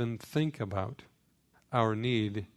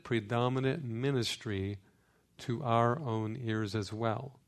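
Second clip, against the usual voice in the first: ordinary delivery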